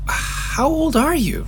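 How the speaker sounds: slow, high-pitched disbelief; incredulous. Acted. Man learning the alien answers to parents, incredulous. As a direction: draw out the question, pitch soaring.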